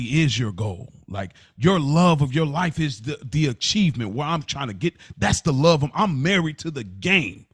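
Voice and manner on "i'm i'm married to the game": ordinary talk, nothing marked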